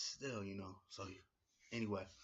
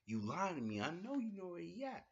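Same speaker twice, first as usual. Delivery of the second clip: mock accusation climbing higher; Rusev accusing; 9 semitones higher, 4 dB louder than usual. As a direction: push the accusation up in pitch. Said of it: "He's like".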